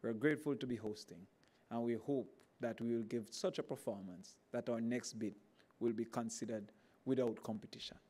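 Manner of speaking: ordinary talk, nothing marked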